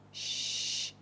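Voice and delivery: soft, long hushing sound; polite shush. Shown as a demonstration. Moviegoer shushes people talking in theater, polite soft shush. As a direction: gently shush someone talking nearby. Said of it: "and you politely say".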